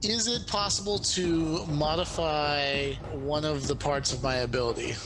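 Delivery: ordinary talk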